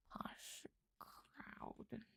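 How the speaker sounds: put-on whispered posh British accent. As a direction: murmur it like a posh Brit. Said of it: "Where's the accent from?".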